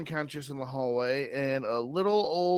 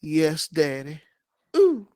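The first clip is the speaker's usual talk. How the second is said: Sally coy; 2 semitones higher, 9 dB louder than usual. Acted. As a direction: purr then squeal. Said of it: "Sally looks at Omar and goes".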